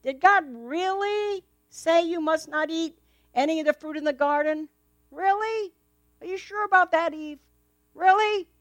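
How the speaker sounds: high, sly, doubting snake; a coaxing serpent, sowing doubt; hissing serpent. Acted. Serpent tempting Eve in story.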